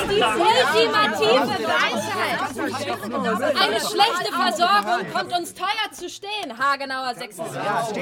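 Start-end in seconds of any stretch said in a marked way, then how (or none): 0.1-6.6 raised, mocking voice; loud sarcastic retort. The chair mocks the dog speaker before the meeting, loud and sarcastic. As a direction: throw it back at him, loud and scornful.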